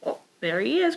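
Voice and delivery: usual speaking voice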